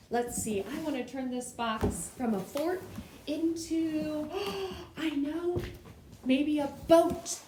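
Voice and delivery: put-on loud, high, bubbly call to young viewers; big eager children's TV voice; excited kids' host. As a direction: sell the fun to the kids at home. Excited show host talking to kids.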